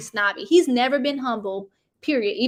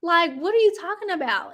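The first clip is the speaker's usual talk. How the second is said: high, loud shocked voice; wife hearing the news, mock shocked; 6 semitones higher, 4 dB louder than usual. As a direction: play the stunned wife. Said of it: "And Chantel's like".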